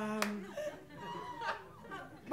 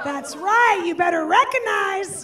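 loud, higher sassy boast; sassy brag; 6 semitones higher, 6 dB louder than usual. Acted. Boasting to audience about her ten-pound baby. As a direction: demand respect, swaggering.

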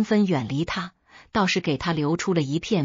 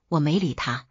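ordinary delivery

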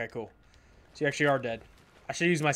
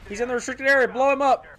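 high, loud urgent order; raised urgent call to teammates; urgent callout; 10 semitones higher, 7 dB louder, faster than usual. Real, not acted. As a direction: shout it to the team before it's too late.

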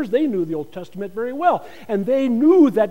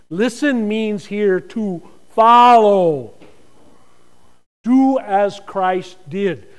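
louder and slow, every word weighed; emphatic climax; 7 dB louder, much slower than usual. Shown as a demonstration. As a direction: land the true meaning of Listen, slowly. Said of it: "Listen means more than".

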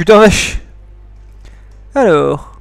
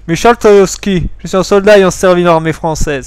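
put-on Polish accent that comes out very Russian; Slavic accent; 4 dB louder than usual. Accused soldier gives name and service, Russian-sounding accent. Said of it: "Ça fait très russe".

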